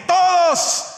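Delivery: todos called out high and loud. Real, not acted. Preacher shouting todos to the congregation about families. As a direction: ring out todos to the church.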